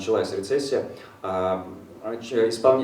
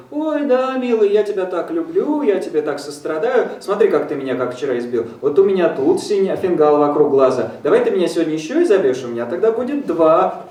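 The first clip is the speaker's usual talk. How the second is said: high, louder mock adoration; doting wife; 7 semitones higher, 5 dB louder than usual. Interpreter voices the beaten wife, high loud adoring coo. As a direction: coo it like a blind devotee. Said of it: "она ему говорит".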